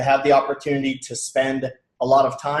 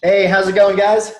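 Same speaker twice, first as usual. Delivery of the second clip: loud, high, upbeat hello; excited greeting; 6 semitones higher, 7 dB louder than usual. Real, not acted. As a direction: greet the crowd with big energy. Energetic greeting to audience.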